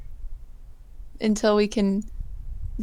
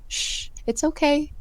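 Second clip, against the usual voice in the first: soft, higher soothing hush; comforting; 4 semitones higher, 3 dB quieter than usual. Acted. Comforting a crying man freaking out, soft and soothing. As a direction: shush and gently reassure. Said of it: "like, shush him and".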